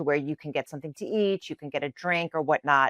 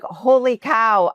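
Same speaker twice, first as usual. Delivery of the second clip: a louder, slower "Holy cow", higher up; amazed exclamation; 6 semitones higher, 5 dB louder, slower than usual. Retold or imitated. Pilgrim stunned the stranger speaks English, amazed. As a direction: gasp it out in wonder.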